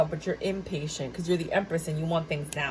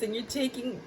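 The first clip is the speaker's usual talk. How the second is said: normal talking voice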